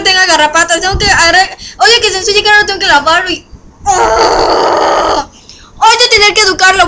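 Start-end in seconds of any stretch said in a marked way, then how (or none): 3.9-5.3 voiceless frustrated outburst; frustrated breathy cry. Real, not acted. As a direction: exhale in exasperation. Frustración con el perro.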